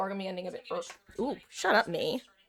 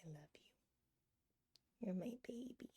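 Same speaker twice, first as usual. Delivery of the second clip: tender whisper to the cat; 3 semitones higher, 16 dB quieter, faster than usual. Real, not acted. Streamer to her cat on her lap, tender whisper. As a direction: breathe it softly to your pet.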